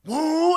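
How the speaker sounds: loud, high "whoa"; sudden high-pitched cry of shock; imitated startled yell. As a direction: whoop in alarm, reliving a near miss. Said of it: "I remember flooring and going".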